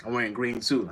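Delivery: ordinary talk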